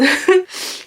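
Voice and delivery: high embarrassed laugh through tears; real tearful giggle; teary laugh. Real, not acted. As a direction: laugh off the crying. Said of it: "I'm a big baby".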